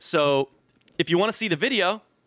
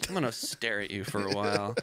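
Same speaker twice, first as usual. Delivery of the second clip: quiet inner thought; 9 semitones lower, 6 dB quieter than usual. Retold or imitated.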